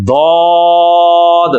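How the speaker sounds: ضاد drawn out very slowly, thick; ضاد held long, with a thick sound; heavy zwaad. Shown as a demonstration. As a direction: model ضاد with a full heavy sound, stretched. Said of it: "زبان کی جو side ہے".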